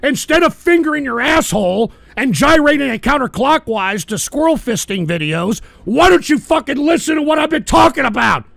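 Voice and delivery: loud, high, shouted tirade; furious rant. Real, not acted. Streamer berating a chatter, shouting in rage. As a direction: tear into him at full volume. Ranting back at a caller.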